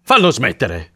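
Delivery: exasperated demand at normal volume; insist, without shouting this time; worn-down, exasperated order; restrained repeated plea. A narrator's reading. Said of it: "urlò l'uomo".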